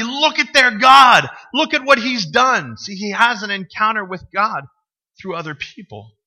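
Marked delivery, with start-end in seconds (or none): 0.0-2.8 loud slow royal proclamation; booming, drawn-out decree; proclaiming king. Retold or imitated. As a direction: declare it like a boastful king. King proclaiming about Hebrew God.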